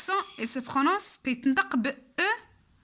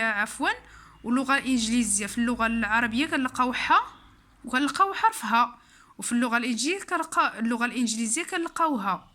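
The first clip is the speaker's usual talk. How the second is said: usual speaking voice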